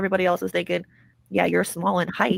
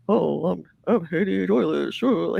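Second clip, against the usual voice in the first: mocking same voice; slower than usual. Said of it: "It's the same fucking voice".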